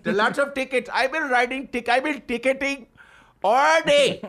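put-on high, sing-out Indian accent. Ticket writer boasting of his day, Indian accent. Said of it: "the guy that writes tickets is an Indian".

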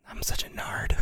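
hushed, voiceless confession; self-mocking whisper. Embarrassed passenger after misunderstanding the cab driver, retold in a whisper. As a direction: whisper it as a sheepish aside to yourself.

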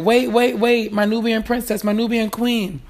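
eager sister. Retold or imitated. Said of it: "his sister was like".

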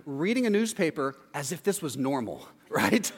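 ordinary talk, nothing marked

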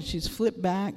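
usual speaking voice

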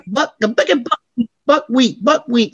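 high, chopped, stuttering sample; scratched sample. Retold or imitated. DJ mimicking scratched [name] record, high stutter.

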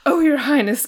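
mild gasp of delighted awe. A narrator's reading. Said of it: "gasped our hero".

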